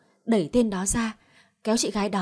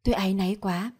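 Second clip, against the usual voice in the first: ordinary delivery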